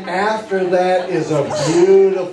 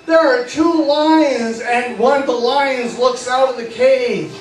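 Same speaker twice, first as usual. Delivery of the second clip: excited parade story; 5 semitones higher than usual. Acted. Hypnotist walking the six-year-olds through the zoo parade, bright and eager. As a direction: paint the parade like a thrilled children's entertainer.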